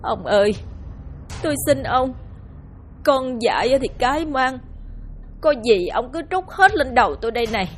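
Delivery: a touch of weeping as she pleads; sobbing plea. Acted. Mother clings to husband's legs begging for her son, tearful plea. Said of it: "khóc nức nở van xin".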